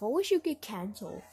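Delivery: ordinary delivery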